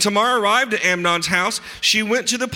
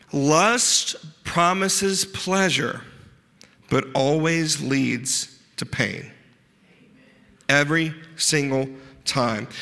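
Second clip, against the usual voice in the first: slow; slower than usual. Acted. Preacher's slow key statement.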